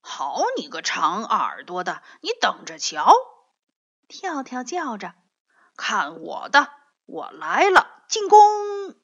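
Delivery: shouted attack cry; playful yell. A narrator's reading. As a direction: charge into the snowball fight.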